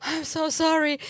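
high, drawn-out sorry; re-enacted apology. Passenger apologising out the taxi window, high mock apology. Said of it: "Mä olin se".

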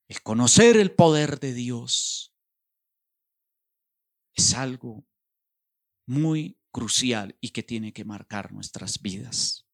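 ordinary delivery

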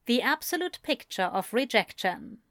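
usual speaking voice

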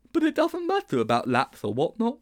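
high-pitched character protest; Sponge protesting. Acted. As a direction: object in a high schoolboy voice. Sponge voiced in story.

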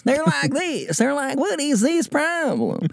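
very high, cartoonish Nebraskan student; exaggerated high-pitched Nebraskan caricature; mock Nebraska accent. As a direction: squeak out a silly Nebraska accent. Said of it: "what the accent is in Nebraska".

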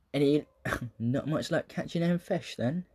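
a hint of a Cornish local asking about fish; Cornish accent. Cornish passerby teasing fishermen, lightly put-on Cornish accent. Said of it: "it was very Cornish".

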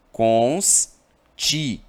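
Constituinte very slow, syllable by syllable; syllable split. Shown as a demonstration. Teacher separates Constituinte into syllables for students, slowly. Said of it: "Se eu separo constituinte".

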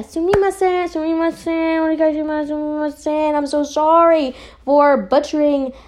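high-pitched, repetitive pleading apology; playful apologizing. Real, not acted. Reviewer apologizing to the creators for botched names, pleading. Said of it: "So I apologize".

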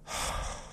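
long unvoiced sigh of frustration; exasperated sigh. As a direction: let out a weary, frustrated breath. Voices lingering frustration without words.

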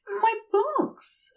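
sudden high cry of dismay; exclaiming. Acted. Aziraphale suddenly remembers his books, high alarmed cry. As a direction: blurt it in alarm. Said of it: "Aziraphale exclaims".

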